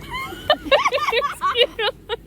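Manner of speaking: shrieking giggle; high squealing laugh. Real, not acted. Loud laugh in hammock.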